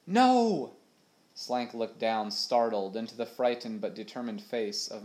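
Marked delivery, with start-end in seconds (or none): 0.1-0.7 high, loud protest; Molly interrupting the captain, loud and high; sharp cry. A narrator's reading. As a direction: cut him off with a sudden no. Molly cuts in, alarmed.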